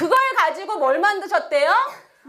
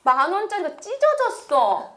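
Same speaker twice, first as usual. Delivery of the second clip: put-on unhappy young son; 3 semitones higher, slower than usual. Youngest son grumbling to mom about torn money, unhappy. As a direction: complain to mom like an upset kid. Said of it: "이러는 거예요".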